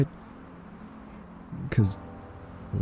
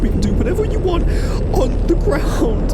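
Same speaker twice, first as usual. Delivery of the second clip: much higher and louder, desperate begging; terrified pleading; 5 dB louder than usual. Acted. Captive begging the robot not to drop her, terrified and loud. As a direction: plead for your life, high above the city.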